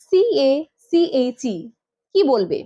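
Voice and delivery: ordinary delivery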